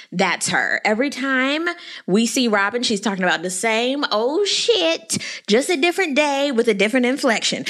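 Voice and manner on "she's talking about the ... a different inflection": ordinary talk, nothing marked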